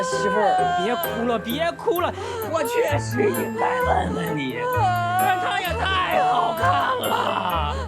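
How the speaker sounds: very high, desperate pleading; wailing apology. Acted. Husband begs crying wife to forgive.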